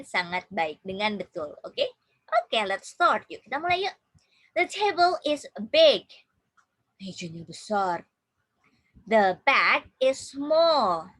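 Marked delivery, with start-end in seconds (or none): 4.5-6.1 loud, higher model sentence for repeating; raised voice, teacher reading aloud. Shown as a demonstration. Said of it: "Please repeat after me". 9.0-11.1 slow, loud model sentence; teacher reading very slowly and loudly. Shown as a demonstration. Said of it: "Please repeat after me".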